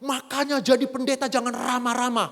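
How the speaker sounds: high, loud mock scolding; imitating angry wife. Wife scolding her pastor husband for friendliness, mock angry. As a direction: scold like a fed-up wife, acted out. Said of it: "dia marah sekali. Dia bilang".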